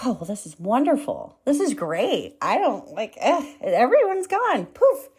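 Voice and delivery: giddy and bright, climbing to a high squeal on Poof; mock relieved delight. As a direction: act thrilled that the trolls vanished. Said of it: "I was like".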